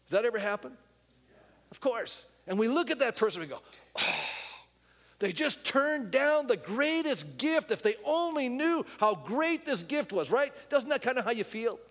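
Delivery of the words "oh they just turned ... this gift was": slow, high, sighing letdown; disappointed lament. Pretend. Believer grieving a rejected gospel, slow lament. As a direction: mourn the refused gift slowly.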